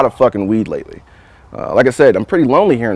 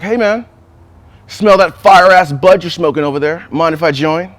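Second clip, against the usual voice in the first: calling out; 5 semitones higher, 4 dB louder than usual. Retold or imitated. Neighbor calls over from balcony.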